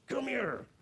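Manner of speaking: high, sharp come here; harsh command. Retold or imitated. What a harsh God would say, a sharp high command. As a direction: snap out the order. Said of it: "gentleness and tenderness doesn't allow him".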